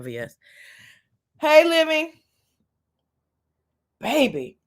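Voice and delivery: normal talking voice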